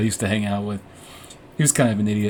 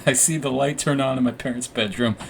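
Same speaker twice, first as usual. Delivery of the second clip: normal talking voice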